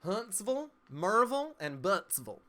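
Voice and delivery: high, loud Southern town names, falling on the last; put-on Southern accent, starting pitched high. Shown as a demonstration. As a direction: list towns like a Southerner, endings reduced to "-vul". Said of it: "since I did it with that accent".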